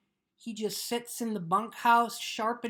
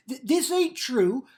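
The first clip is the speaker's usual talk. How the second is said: louder, anxious pleading denial; upset protest; 4 semitones higher, 7 dB louder than usual. Acted. Lenny frightened by Crooks' talk, loud upset protest. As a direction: push back, rattled and scared.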